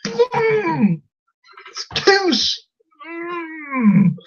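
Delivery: high, strained groan of frustration; worked-up, wordless frustration; frustrated groan. Acted. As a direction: bottle up a squeal of exasperation. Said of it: "it frustrated me".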